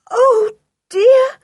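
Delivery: gasped, high wailing dismay; gasp, then cry it out high and loud; shocked aunt near tears; distressed. A narrator's reading. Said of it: "said poor Aunt Fanny".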